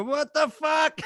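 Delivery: high, stunned outburst; seeing a familiar face in a movie, shocked outburst; shocked exclamation. Retold or imitated. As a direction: blurt it out in sudden disbelief.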